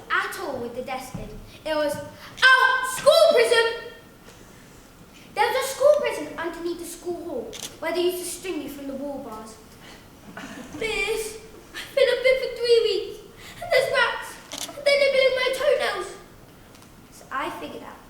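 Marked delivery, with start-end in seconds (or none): 1.9-3.7 loud, high shouted sentence; strict teacher. Acted. Teacher punishing desk-lid noise, loud angry command. 10.8-16.1 high, loud wail of complaint; pleading pupil. Acted. Pupil up the wall bars to miss, high mock wail. Pupil strung up in school prison.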